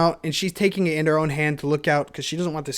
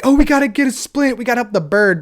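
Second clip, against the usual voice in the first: higher, louder panicky helper; mock eager alarm; 7 semitones higher, 5 dB louder than usual. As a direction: imitate an overeager rescuer rushing in. Imitating overeager Americans rushing to help.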